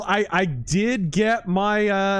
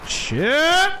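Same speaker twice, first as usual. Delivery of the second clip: high sharp yelled curse; loud curse; 9 semitones higher, 5 dB louder than usual. Real, not acted. Sudden gunfight surprise.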